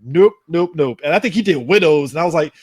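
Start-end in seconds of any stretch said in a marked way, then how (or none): none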